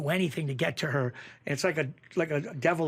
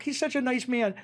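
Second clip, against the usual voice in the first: higher mock-sweet wife voice; lightly voiced admiring wife; 9 semitones higher than usual. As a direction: imitate the wife gushing about a coworker in a higher voice. Mimicking wife praising coworker.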